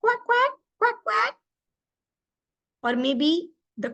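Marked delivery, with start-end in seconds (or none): 0.0-1.4 higher, squawky cartoon duck quacks; playful cartoon duck squawking; Donald Duck. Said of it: "How will Donald Duck say it?".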